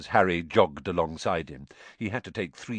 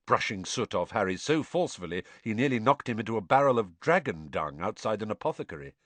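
usual speaking voice

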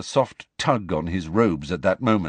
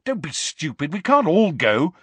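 angry; 8 semitones higher, 3 dB louder than usual. A narrator's reading. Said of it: "said Harry angrily".

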